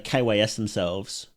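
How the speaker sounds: normal talking voice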